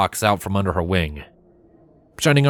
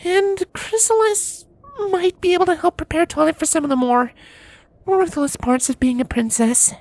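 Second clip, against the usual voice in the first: grudging Cadance. A narrator's reading. Cadance reluctantly admitting to her husband, high voice. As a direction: concede it unwillingly, up high. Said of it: "admitted Cadance grudgingly".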